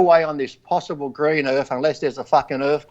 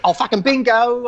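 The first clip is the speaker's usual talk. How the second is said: mock bingo win; 8 semitones higher than usual. Winning at bingo.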